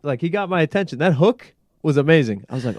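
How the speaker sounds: ordinary delivery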